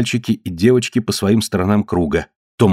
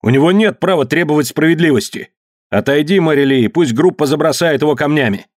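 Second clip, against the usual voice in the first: higher-pitched insistent demand; heated cry; 6 semitones higher than usual. A narrator's reading. Tombol orders Mary Lee aside, heated high demand. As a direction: insist over her, voice raised in pitch. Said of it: "крикнул Томбол".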